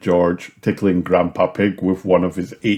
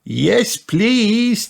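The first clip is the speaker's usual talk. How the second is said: put-on high child-like cheer; cheering children. A narrator's reading. Peppa and George answer Granny, high cheering. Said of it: "cheered Peppa and George".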